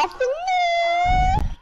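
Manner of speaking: high, hammed-up crying whine; mock whine. Complaining to the lobby about the nickname, high mock whine. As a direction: whine it like a fake sob.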